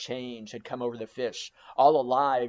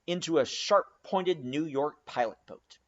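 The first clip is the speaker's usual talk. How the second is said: ordinary delivery, nothing marked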